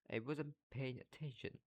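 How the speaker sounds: mildly theatrical sulk; understated drama queen; dramatic. A narrator's reading. Myrtle brushing off Harry's question, mildly dramatic. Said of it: "said Myrtle dramatically".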